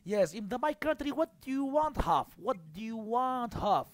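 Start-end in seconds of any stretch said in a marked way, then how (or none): none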